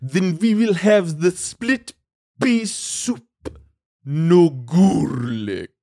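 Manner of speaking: slow, drawn-out fake Eastern European accent; imitating a fake Eastern European accent; mock Eastern European accent. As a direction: stretch it out in a put-on vague Eastern European accent. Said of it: "And she's like".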